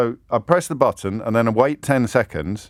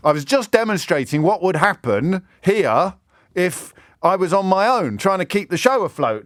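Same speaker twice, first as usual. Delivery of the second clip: emphatic demonstrating; 6 semitones higher than usual. Real, not acted. Host dramatizes losing his producer.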